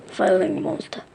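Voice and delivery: ordinary delivery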